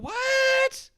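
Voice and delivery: very high, loud yelp of disbelief; a hammed-up stunned what; shocked what. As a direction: shriek it in disbelief. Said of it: "And I went".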